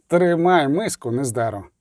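usual speaking voice

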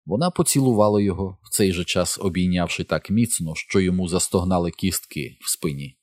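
plain reading voice

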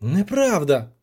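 understated indignant protest; a touch of offended outburst. A narrator's reading. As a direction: object as if wrongly accused. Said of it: "обурився Трубадур".

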